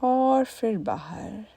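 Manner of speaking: very slow, stretched words; drawn-out exhale cue. Shown as a demonstration. Meditation teacher pacing listeners' exhale, slow and drawn out. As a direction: draw it out along the outgoing breath.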